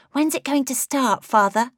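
put-on high, bright child's voice asking father; a boy impatient for the show; young prince pestering his father, high childish voice; eager young boy.

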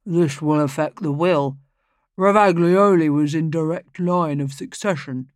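a self-important lawyer making a suggestion; pompous lawyer. Acted. Lawyer on the will.

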